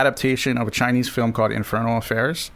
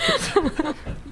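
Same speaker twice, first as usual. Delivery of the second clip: high laugh; 5 dB quieter than usual. Real, not acted. Laughing at joke.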